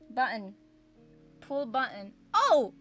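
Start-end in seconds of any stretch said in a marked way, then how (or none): 2.3-2.7 sudden high yelp; surprised shout; startled yelp. Real, not acted. Sudden dark screen.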